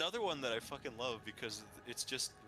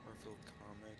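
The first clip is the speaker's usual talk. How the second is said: trailing off; 2 semitones lower, 11 dB quieter than usual. Real, not acted. Muttering while typing a search.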